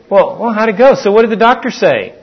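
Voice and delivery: eager question. Retold or imitated. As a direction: ask as if you truly want to know. Hoped-for husband question.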